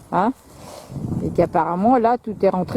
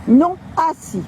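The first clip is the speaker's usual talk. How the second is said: firm dog command; 8 semitones higher, slower than usual. Real, not acted.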